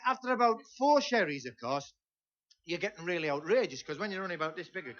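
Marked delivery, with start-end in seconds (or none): none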